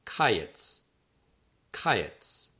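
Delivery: "kayetz" said as KAI-yets, stress on the first syllable; careful Hebrew "Kayetz", KAI-yets; Hebrew pronunciation. Shown as a demonstration. Author teaching readers a Hebrew word, careful KAI-yets. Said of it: "that's pronounced".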